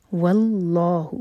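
voiced, very slow tajweed recitation; slow Quran recitation. Shown as a demonstration. Teacher recites the verse to show the rules, slowly. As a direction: draw each syllable out carefully. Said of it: "لام جلالہ کو heavy پڑھیں گے".

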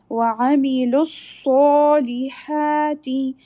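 chanted tajweed recitation; Quran recitation. Shown as a demonstration. Recites the verse.

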